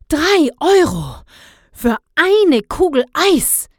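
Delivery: hohe, laute, gedehnte Empörung; fassungslos japsend. A narrator's reading. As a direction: fassungslos nach oben kippen, jedes Wort auskosten. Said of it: "japste Herr Blauberg fassungslos". (entsetzt aufjapsend)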